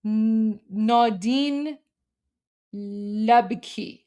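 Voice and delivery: careful reading with gaps between words; tentative reader decoding the script; sounding out letters. Shown as a demonstration. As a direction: read each name out separately. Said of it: "I can read this".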